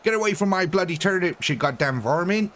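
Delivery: hammed-up West Country farmer accent; mock West Country yokel accent. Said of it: "the West Country as they call it over here, accent".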